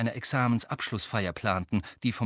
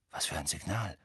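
murmur; 5 semitones lower, 6 dB quieter than usual. Acted. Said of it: "murmelte Harry".

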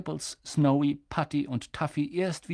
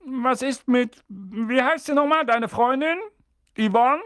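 high, louder put-on voice; husband fishing for another babysitter; higher voiced uncle; uncle asking; 11 semitones higher, 5 dB louder than usual. A narrator's reading. Vernon asks wife.

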